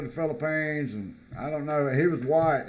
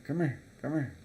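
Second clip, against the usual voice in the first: quieter, gentle beckon; soft beckoning come here; 7 dB quieter than usual. Shown as a demonstration. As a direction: say it softly, like a polite call. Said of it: "Just, just do it like this".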